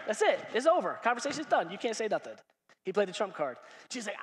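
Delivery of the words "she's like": normal talking voice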